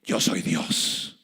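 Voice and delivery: low, slow, hushed antichrist voice; a menacing low boast. Retold or imitated. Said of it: "va a decir". (voz baja y lenta del anticristo)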